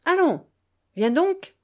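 high, loud, daring challenge; defiant. A narrator's reading. Mother at the door challenging grandfather, defiant. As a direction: dare him to follow.

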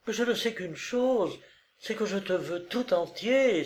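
high-pitched but contained passionate cry; overflowing passion. A narrator's reading. Olga wants all of her lover, passionate. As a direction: pour it out high without volume. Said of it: "s'écria-t-elle avec une passion qui débordait".

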